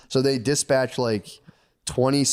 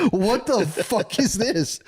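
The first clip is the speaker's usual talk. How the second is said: high, slow, stunned what-the-fuck; shocked disbelief; 9 semitones higher, much slower than usual. Retold or imitated. Cortez horrified at the temple, stunned and slow.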